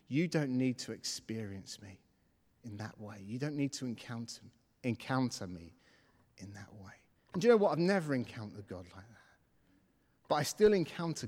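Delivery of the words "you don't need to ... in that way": low, soft reassurance; really gentle. Retold or imitated. God reassuring a discouraged believer, really gentle. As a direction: lower the voice and soothe, fading away. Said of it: "he said to me really gently".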